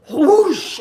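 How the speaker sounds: loud, high rushing whoosh of a wave; big swooshing wave sound. Retold or imitated. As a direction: blast out the wave noise. Imitating the wave's rush.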